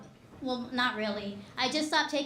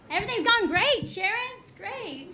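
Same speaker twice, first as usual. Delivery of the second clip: hammed-up "all is well", pitched way up; fake cheeriness; 9 semitones higher than usual. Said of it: "so I just say".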